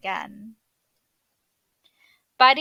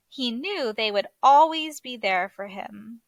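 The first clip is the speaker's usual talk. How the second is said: ordinary delivery, nothing marked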